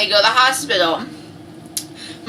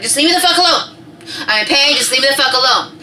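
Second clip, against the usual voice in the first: higher, louder fed-up snapping; irritated; 6 semitones higher, 3 dB louder than usual. Retold or imitated. Wife in labor to comforting husband, irritated. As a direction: snap at someone to back off. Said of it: "I was so irritated".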